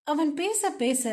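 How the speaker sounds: usual speaking voice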